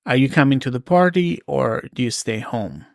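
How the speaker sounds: ordinary talk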